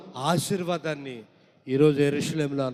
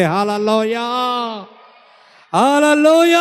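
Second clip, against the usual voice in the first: loud hallelujah; 7 semitones higher, 8 dB louder than usual. Real, not acted. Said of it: "గట్టిగా చెప్పు".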